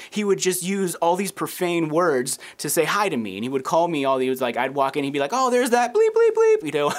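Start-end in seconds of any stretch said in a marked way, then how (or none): 5.3-6.7 high, loud hammed-up shout of greeting; imitating the rowdy teenage worker, loud and high; mock cursing greeting. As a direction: bellow it across the shop like a cheeky teen.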